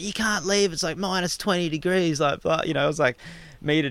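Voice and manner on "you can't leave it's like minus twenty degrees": higher-pitched retold objection; imitated protest. Girlfriend arguing against a late walk, imitated higher-pitched protest.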